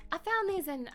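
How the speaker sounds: eager high Southern character voice; Southern accent. Acted. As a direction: pitch it up with a Southern twang. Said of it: "she's a little bit Southern".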